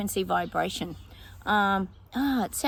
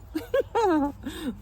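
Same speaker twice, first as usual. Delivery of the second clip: giggling; 8 semitones higher than usual. Real, not acted. Still laughing at the vision.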